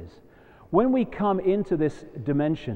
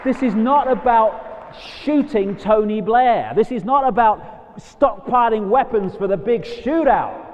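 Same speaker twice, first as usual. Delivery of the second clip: louder and higher, hammering every not; emphatic declaration; 6 semitones higher, 4 dB louder than usual. Real, not acted. To the audience, rejecting the shootout, a loud emphatic declaration. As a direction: preach it hard, stressing not. Passionate rally against violence.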